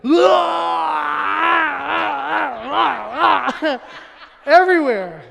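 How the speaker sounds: loud, high gagging burst into laughter; loud retching. Retold or imitated. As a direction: explode into a huge retch. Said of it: "A child goes".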